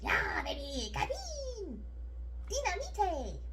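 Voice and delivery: high squeaky cartoon squirrel voice; squirrel impression. Cohost repeating the caffeinated squirrel's lines, high squeaky voice. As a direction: bounce it like a hyper squirrel. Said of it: "that's a similar voice".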